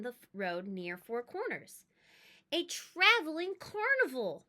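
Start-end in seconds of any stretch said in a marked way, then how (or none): 2.5-4.4 excited shout. Acted. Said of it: "my brother shouted".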